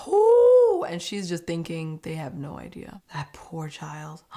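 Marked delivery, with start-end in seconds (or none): 0.1-0.9 long, high, loud sympathetic "ooh". Real, not acted. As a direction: let out a high drawn-out "ooh". Reacts to the painful question.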